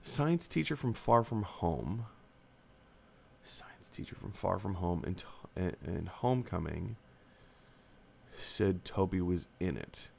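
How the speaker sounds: muttering to himself. Real, not acted. Reading comment to himself.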